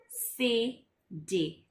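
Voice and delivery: slow recap. Shown as a demonstration. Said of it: "recap, said slowly".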